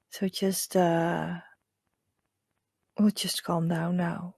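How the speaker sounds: hushed guide leading breath and visualization; hypnotic meditation voice. Acted. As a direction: lull them gently into calm. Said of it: "that's my hypnotic voice".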